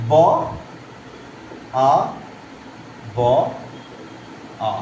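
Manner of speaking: "ব, আ, ব, আ" with a long gap after each; sound-by-sound breakdown. Shown as a demonstration. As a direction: break it into separate "ব, আ, ব, আ". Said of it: "এই যে ভেঙে তোমাদের বোঝালাম".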